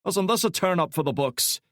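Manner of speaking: wry Scottish burr, amused; gruff Scottish voice. A narrator's reading. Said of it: "a gruff Scottish voice remarked".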